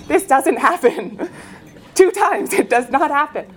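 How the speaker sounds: laughs through the words, disbelief; laughing. Real, not acted. Laughing at herself for insisting she won't get sick. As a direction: laugh it off, half rueful. Laughing at her own denial.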